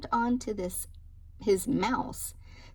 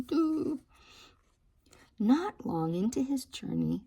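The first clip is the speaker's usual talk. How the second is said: usual speaking voice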